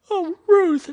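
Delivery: high, loud horrified cry; girl cries out in sudden horror. Acted. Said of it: "she cried".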